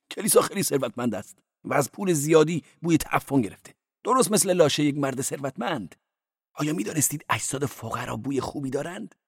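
높은 성직자 목소리로 조용히; quietly cleric. Acted. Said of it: "آرام گفت".

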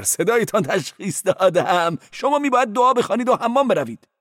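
high-voiced cleric. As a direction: play the cleric high and quick. Cleric diagnosing.

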